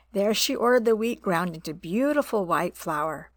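ordinary delivery

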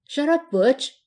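plain reading voice

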